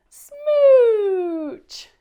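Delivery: very loud, high, drawn-out smooch; huge smooch. Acted. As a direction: belt out one giant smooch. Big final smooch.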